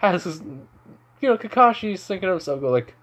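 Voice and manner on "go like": plain reading voice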